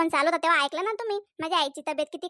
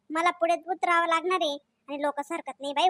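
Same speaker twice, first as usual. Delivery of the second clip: ordinary delivery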